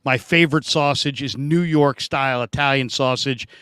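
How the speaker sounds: ordinary talk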